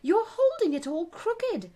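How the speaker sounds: high, loud alarmed warning; cried out. A narrator's reading. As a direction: urgently cry a correction. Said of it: "Alice cried".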